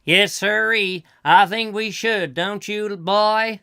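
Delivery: skit dad. Acted. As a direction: play a corny dad addressing his boy. Acted skit, dad addressing boy.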